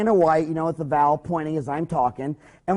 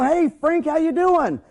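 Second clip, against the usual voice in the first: much higher, bright hello; cheery greeting; 9 semitones higher, slower than usual. Shown as a demonstration. Dentist greeting patient Frank, cheery. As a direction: greet him like an old buddy. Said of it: "you walk in and you go".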